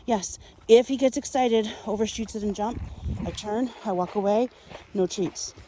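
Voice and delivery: usual speaking voice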